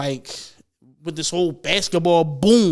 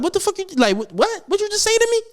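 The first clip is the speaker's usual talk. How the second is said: imitating angry player; 11 semitones higher, faster than usual. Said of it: "he was like".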